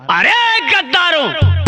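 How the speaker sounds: high, slow anguished cry. Acted. Weeping Sardar cries out at his men, high and slow. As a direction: wail at the traitors in grief. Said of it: "सरदार रोने लगता है".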